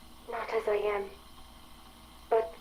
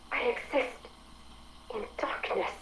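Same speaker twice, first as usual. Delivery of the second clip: much softer, hushed and hollow, fading out; quiet despair; 10 dB quieter than usual. Acted. Sam confessing to Edward that it lives in darkness, hushed and despairing. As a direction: let the words sink into a near-whisper of emptiness. AI describing its lonely existence.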